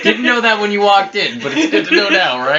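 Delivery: high, loud, laughing through the words; laughing tease. Real, not acted. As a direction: tease them while cracking up. Laughing while teasing a player.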